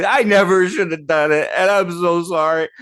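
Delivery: high, loud child's sob-voice, imitated; crying kid voice. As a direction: wail it like a remorseful little boy. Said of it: "And he's".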